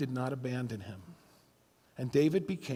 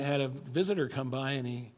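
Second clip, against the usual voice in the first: ordinary talk, nothing marked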